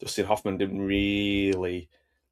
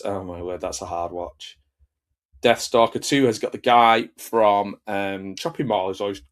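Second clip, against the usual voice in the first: ordinary delivery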